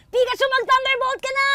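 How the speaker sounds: high shouted battle cry; Ash voice. Retold or imitated. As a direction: call out the attack like Ash. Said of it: "naririnig ko na yung".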